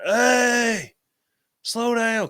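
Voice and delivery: high, loud call to someone racing away; retold holler. Dad calls after his speeding daughter, hollering. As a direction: re-enact hollering downhill after a kid. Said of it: "And I'm hollering".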